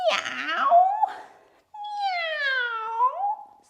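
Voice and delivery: high, stretched rising meow; cat meow. Acted. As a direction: mew like a sweet cat. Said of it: "says the cat".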